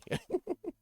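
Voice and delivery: squeaky burst of laughter; high giggle. Real, not acted. Laughs at own joke.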